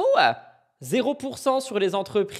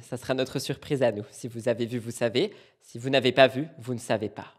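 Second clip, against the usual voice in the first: ordinary delivery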